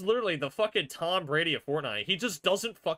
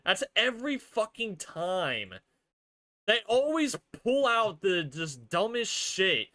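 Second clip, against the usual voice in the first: high, aggrieved griping; exasperated complaint; 5 semitones higher than usual. Real, not acted. Fan griping that they always pull it out, exasperated. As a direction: complain with the pitch pushed up. Still ranting about the rival winning.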